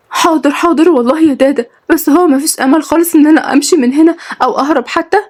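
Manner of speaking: put-on high, worried young woman; anxious pleading. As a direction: agree hastily, then plead for hope. Captive asks about any escape.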